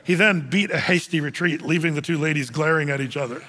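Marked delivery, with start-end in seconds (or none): none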